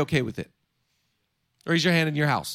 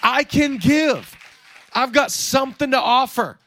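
raised grateful shout of faith; joyful declaration; 5 semitones higher, 4 dB louder than usual. Acted. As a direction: declare it with thanks. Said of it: "just thank God".

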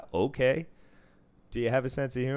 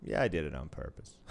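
ordinary talk

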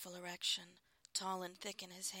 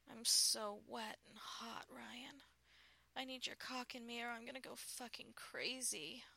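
ache with impatience, higher than usual; aroused urgency; 5 semitones higher than usual. Acted. Wife telling her husband she wants him, longing.

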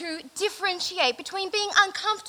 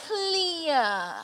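clear demo; 3 semitones higher than usual. Shown as a demonstration.

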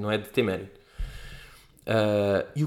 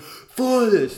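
higher, slow mock yell; half-hearted retold yell; mock shout; 10 semitones higher, 4 dB louder than usual. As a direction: imitate the kid screaming. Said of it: "a gritar".

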